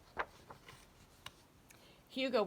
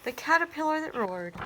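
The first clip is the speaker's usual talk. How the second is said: a storyteller presenting the title; title read; 7 semitones higher, 8 dB louder than usual. A narrator's reading. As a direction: announce the title, higher and louder. Reads title aloud.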